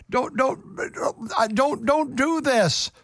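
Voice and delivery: high, pained plea not to take his son; pleading father. Acted. Jacob begging his sons not to take Benjamin, high pleading. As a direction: beg like a grieving old father.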